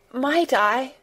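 mildly quavered, hesitant request; quavering. A narrator's reading. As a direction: begin timidly and leave it hanging. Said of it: "quavered Mary".

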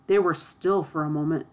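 usual speaking voice

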